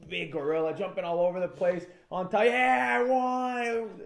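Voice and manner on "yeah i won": high, loud cheering mock victory cry; gloating fighter. Palhares celebrating on top, hammed-up cheer.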